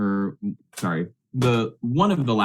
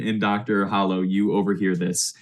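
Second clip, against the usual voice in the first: ordinary talk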